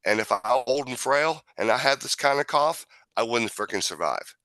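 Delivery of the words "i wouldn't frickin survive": ordinary delivery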